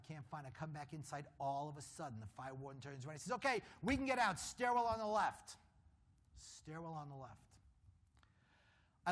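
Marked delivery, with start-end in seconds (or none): 3.3-5.6 raised, higher call; announcing the way out. Retold or imitated. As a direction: call out the exit to the group. Fire warden announces the exit.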